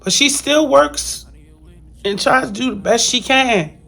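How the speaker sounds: holding back tears; emotional. Real, not acted. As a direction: voice wavering with emotion. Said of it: "I'm sorry, I'm getting emotional".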